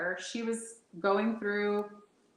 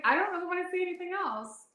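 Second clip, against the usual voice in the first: high panicked; 10 semitones higher, faster than usual. Retold or imitated. Retold inner panic, high fast.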